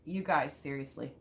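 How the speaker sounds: ordinary talk, nothing marked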